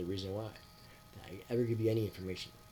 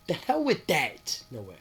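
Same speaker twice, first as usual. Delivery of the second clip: defiant refusal; 4 semitones higher, 7 dB louder than usual. Retold or imitated. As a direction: slam the door on it. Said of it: "And I'm like".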